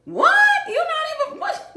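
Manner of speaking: high disbelieving squeak, laughing; incredulous squeal. Retold or imitated. Retelling a stranger's comment to viewers, squealing in disbelief. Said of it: "I was like".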